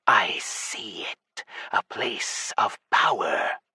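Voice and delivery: slow, hushed, whispery snake god; serpent whisper. Acted. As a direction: breathe it like an ancient serpent.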